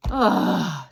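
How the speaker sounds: big demonstrated exhale of bliss; sigh of relief. Shown as a demonstration. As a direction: breathe out long, as if everything melts away. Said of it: "you literally just go".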